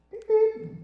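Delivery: high, loud beep-beep; squeaky imitated car horn; car horn imitation. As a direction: honk like a little car horn. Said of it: "That's my car".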